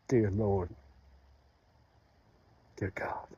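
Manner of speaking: broken, pleading prayer to God, low and hushed at first; grieving prayer. Acted. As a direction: cry out to God in grief, then press on. Prays aloud to God.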